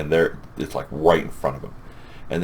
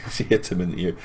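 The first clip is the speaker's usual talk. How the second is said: normal talking voice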